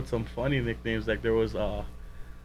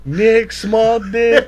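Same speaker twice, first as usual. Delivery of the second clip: teasing Sonny; 10 semitones higher, 12 dB louder, much slower than usual. Retold or imitated.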